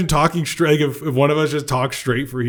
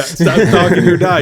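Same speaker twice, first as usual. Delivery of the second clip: emphatic echo; 5 semitones higher, 8 dB louder than usual. Real, not acted. Echoing Jimmy's punchline.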